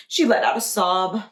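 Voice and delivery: ordinary delivery, nothing marked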